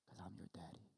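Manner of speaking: very soft, barely voiced reassurance; tender hush. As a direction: let it drop almost to nothing. God's answer, repeated very quietly.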